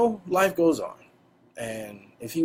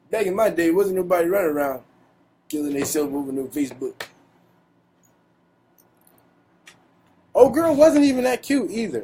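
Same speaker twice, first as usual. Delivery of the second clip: put-on raised, grumbling old-timer voice; old man voice; 5 semitones higher, 4 dB louder than usual. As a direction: grumble like a cranky grandpa. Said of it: "Let me put on my old man voice".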